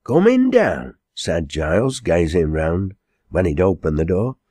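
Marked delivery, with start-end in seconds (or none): 0.0-1.0 high, loud exclamation; emphatic, high-pitched agreement; astonished echo. Acted. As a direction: throw the words back in amazement. Giles echoes her, amazed at snow.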